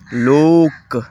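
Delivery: لوک with a held OO, as "look"; long OO sound. Shown as a demonstration. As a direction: stretch the OO in لوک. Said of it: "او کی آواز دیتا ہے". (لوک میں پیش والی لمبی آواز)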